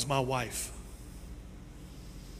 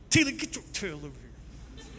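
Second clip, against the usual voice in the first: mock stern call; 3 semitones higher than usual. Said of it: "I like to whisper some wisdom".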